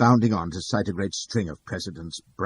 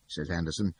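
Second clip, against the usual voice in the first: usual speaking voice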